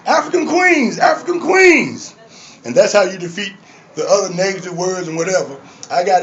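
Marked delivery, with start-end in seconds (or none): 0.1-2.1 high greeting, drawn out at the end; admiring call. Retold or imitated. I call the women in the club, high hailing call. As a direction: hail the women with a lilting call. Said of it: "I call the women African queens".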